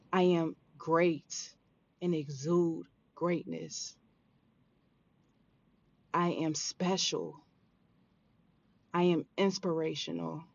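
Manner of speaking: calm, deliberate affirmations to repeat; guided affirmations. Acted. Leads listeners in affirmations.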